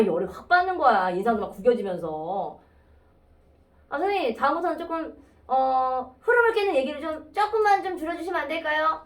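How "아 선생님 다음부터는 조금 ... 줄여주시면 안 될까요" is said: higher, coaxing student voice; cute, tentative plea in a raised voice; cute polite request. Shown as a demonstration. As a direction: ask teacher sweetly, pitched up and hesitant. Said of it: "이렇게 얘기해서 좀 귀여웠을 텐데".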